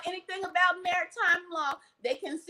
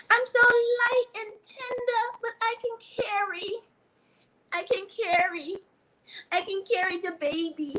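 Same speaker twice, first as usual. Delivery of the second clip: dainty woman voice; 6 semitones higher than usual. Pretend. Preacher voicing the woman as a dainty vessel, high put-on voice. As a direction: play the tender vessel, high and light. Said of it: "Oh, she's so tender".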